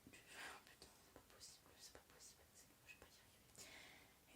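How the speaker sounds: whispered swearing to oneself; hurried, barely audible self-scolding; frustrated whisper. Real, not acted. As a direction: mutter under your breath, stuck on a name. Whispers to self after blanking on name.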